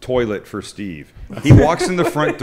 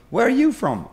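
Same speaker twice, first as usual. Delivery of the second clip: higher, half-hearted attempt at a New Zealand accent; badly faked New Zealand accent; lightly attempted New Zealand accent, pitched up; attempted local accent; 11 semitones higher than usual. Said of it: "I can't do the accent from there".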